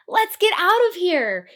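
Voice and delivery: loud, high frightened yell. Acted. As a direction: shout to flee. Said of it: "he yelled to the centaur".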